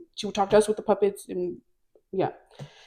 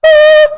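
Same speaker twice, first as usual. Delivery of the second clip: very high, loud mock shriek; comic scream of sudden fright; panicked scream; 16 dB louder than usual. High student panics at the driving instructor, shrieking.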